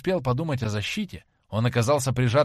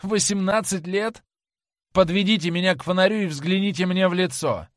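"Восемнадцать лет?" asked much higher and louder in triumph; triumphant; 8 semitones higher, 5 dB louder than usual. A narrator's reading. Dorian to James Vane, high, loud and triumphant. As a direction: crow it, high and loud, sure of your escape. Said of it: "в голосе у него послышалось торжество".

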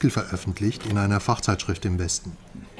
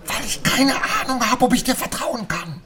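agitated; 11 semitones higher than usual. Acted. Agitated distrustful outburst.

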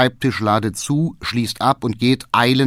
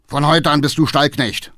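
loud, raised-pitch decree; angry command; 6 semitones higher, 5 dB louder than usual. A narrator's reading. Father demoting his son to stable hand, angry. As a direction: lay down the law furiously. Father issues order in quarrel.

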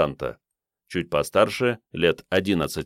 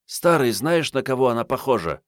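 put-on young woman voice; 7 semitones higher than usual. A narrator's reading. Marietta voiced.

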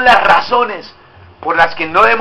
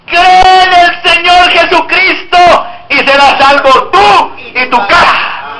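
loud, slow, higher preacher's cry; fiery preaching; 7 semitones higher, 3 dB louder, slower than usual. Acted. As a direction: hammer each promise home, slowly. Preaching the verse.

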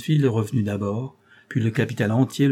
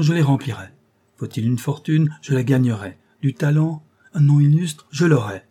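much higher and louder, a passionate vow; delirious exaltation; 4 semitones higher, 5 dB louder than usual. Acted. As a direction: swear it with wild, burning hope. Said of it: "s'écria André avec une exaltation délirante".

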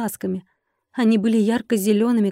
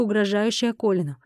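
ordinary delivery, nothing marked

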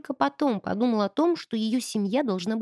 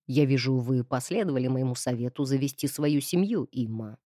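low sneering count; mock him with a sneer; 8 semitones lower than usual. A narrator's reading. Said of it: "Издевательски спросил он".

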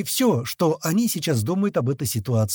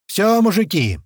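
raised, higher-pitched call; radio shout; 7 semitones higher, 5 dB louder than usual. A narrator's reading. Mage shouting to the men over the radio, raised shout. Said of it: "снова кричит в рацию мага".

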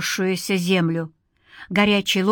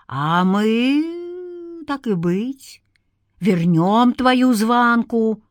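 drawn-out, sly, swooping offer; insinuating; 3 semitones higher, much slower than usual. A narrator's reading. Darkness bargaining with a desperate man, insinuating. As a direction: glide up on the first words and coax. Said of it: "вкрадчиво шепнула тьма".

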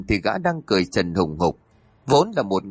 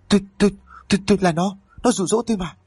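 terrified stammer; 8 semitones higher, much faster than usual. A narrator's reading. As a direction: stumble over tôi in fright, higher. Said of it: "miệng lắp bắp không thành tiếng". (lắp bắp hoảng sợ, giọng cao)

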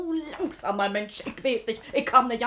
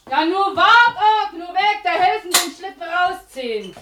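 much higher and louder, calling across; 7 semitones higher, 7 dB louder than usual. Acted. Wife calling to Mitteldorf for help with the sled, loud call. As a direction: shout over to get help with the sled.

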